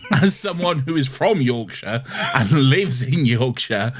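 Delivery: ordinary talk, nothing marked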